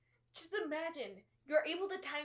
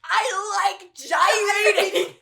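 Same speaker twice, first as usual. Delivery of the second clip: put-on bratty teen yell; 7 semitones higher, 15 dB louder, slower than usual. Rebellious teen yelling at mom.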